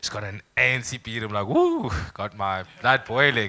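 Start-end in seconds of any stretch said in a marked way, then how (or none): 1.5-1.9 high, playful "woo"; excited whoop. Retold or imitated. Man reacting to an attractive girl, high playful whoop. Acting out his reaction to her.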